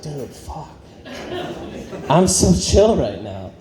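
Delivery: lazy, blissed-out surfer-dude voice; chilled-out antelope. Retold or imitated. Antelope in Africa answering his taped therapy, slow stoner drawl. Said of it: "some antelope in Africa is like".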